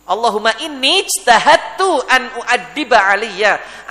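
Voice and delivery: loud, slow Arabic supplication; fervent prayer. Acted. Preacher repeats the father's prayer for Ali, fervent and loud. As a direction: call it out to God, drawing out the words.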